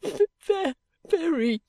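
high, stammering sobs; choked, broken, grateful weeping; sobbing. A narrator's reading. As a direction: stammer the word through tears. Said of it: "sobbed Caddy".

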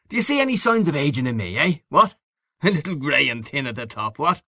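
high, swinging Irish bar-room voice; boisterous Irish man-about-town; hearty Irish old pal. A narrator's reading. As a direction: greet an old pal with breezy swagger. Figure of a hearty old friend in a bar.

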